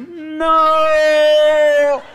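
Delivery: long, loud, high drawn-out wail; hammed-up movie-style howl of protest; slow-motion scream. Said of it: "I could see it in slow motion".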